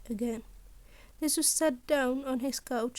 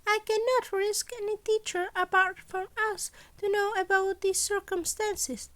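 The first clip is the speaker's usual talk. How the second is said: principal Nezu; 8 semitones higher than usual. Acted. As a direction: play Nezu brightly pleased with his impossible demands. Nezu voiced again.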